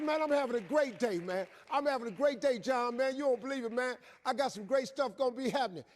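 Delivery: eager show of a great attitude; enthusiastic reply. Acted. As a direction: gush down the phone, wide awake. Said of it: "with the right attitude".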